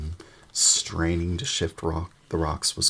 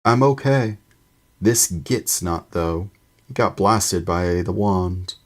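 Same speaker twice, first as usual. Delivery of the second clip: loud call through a wall; 3 semitones higher, 8 dB louder than usual. A narrator's reading. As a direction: shout it across the rubble. Ron calls through rock wall.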